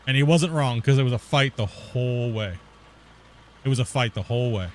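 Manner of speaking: normal talking voice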